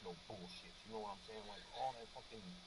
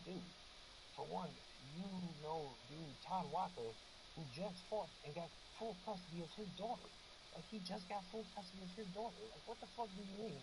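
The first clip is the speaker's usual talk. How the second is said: heated argument; 8 semitones higher than usual. Retold or imitated. His retold argument with friend.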